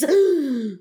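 high, loud yawning intake; big yawn. A narrator's reading. Mock Turtle tires of explaining, loud yawn. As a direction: yawn mid-explanation, loudly. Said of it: "here the Mock Turtle yawned".

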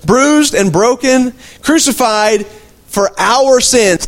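drawn-out solemn sermon recitation; slow preaching. Acted. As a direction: savour every word of the verse. Preaching Isaiah slowly.

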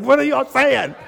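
high, incredulous question to the doctors; mock disbelief. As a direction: act shocked at what they say. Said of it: "I'm like".

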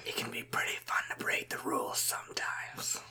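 sly tempting whisper. Acted. Herald tempting Alucard to break rules, sly whisper. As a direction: breathe it like a secret.

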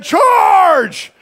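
loud, high Braveheart charge cry; battle yell. Retold or imitated. Boy rallying his friend to chase, loud battle cry. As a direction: yell it like leading an army. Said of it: "And so I yelled at Steve".

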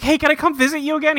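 high, fast, bubbly mock voice; mock eager Queen. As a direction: rush it out hopefully. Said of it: "and she's like".

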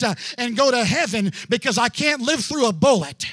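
loud, driving testimony with punched phrases; full-force preaching. Real, not acted. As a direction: preach it with gasped "uh" between phrases.

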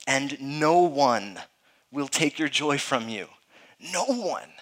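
slow, weighty stress on "no one"; emphatic declaration. Retold or imitated. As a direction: declare it firmly, drawing out "no one". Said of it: "He's declaring this emphatically".

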